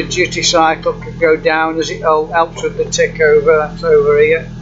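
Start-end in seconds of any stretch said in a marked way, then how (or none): none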